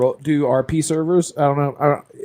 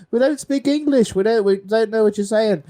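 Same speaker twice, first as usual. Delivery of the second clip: higher-pitched British pleading; mock British accent; 7 semitones higher than usual. Gamer pleading with Russian guards, mock British accent. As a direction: put on a British bloke protesting helplessly. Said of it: "these two British blokes".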